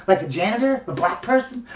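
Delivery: usual speaking voice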